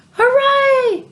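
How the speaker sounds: thrilled shout of hooray; excited shout. A narrator's reading. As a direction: cheer out with boyish joy. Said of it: "shouted George".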